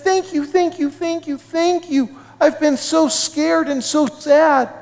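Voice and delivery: put-on high, gushing girl's thanks; acted happy tears in a high little voice; tearfully happy. A narrator's reading. Anna thanking girls for returning her puppy, tearfully happy. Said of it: "even started crying. She was so happy".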